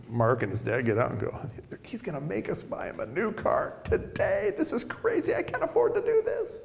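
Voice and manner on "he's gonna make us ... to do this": very high, quiet fretting; hushed panic. Retold or imitated. Father and son at the car dealership, panicking under their breath.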